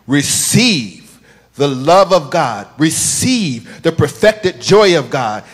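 slow, loud, drawn-out preaching call; preaching his own message. Retold or imitated. Quoting his own sermon to the congregation, slow loud proclaiming. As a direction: proclaim each word slowly like from the pulpit. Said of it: "I actually heard your voice saying".

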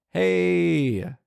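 high mock shouted hey. Man shouting at fighting giants, mock shout. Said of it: "as opposed to shouting".

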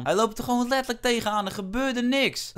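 indignant gamer rant; exasperated protest. Real, not acted. Complaining about an unfair red card.

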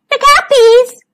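high, loud storybook shout; loud high call. A narrator's reading. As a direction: shout a warning to the bees. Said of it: "he shouted".

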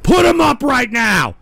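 loud, sharp call to fight; shouted challenge. Real, not acted. Streamer yelling at chat to fight. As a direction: bark it like a dare.

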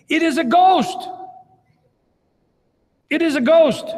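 high, frightened cry of It is a ghost; terrified shout. A narrator's reading. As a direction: cry out as if seeing a ghost on the water. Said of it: "And they cried out for fear".

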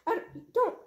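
high panicked yell. Acted. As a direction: shout it in a panic. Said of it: "you don't have to yell".

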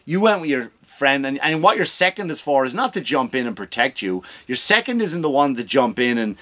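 usual speaking voice

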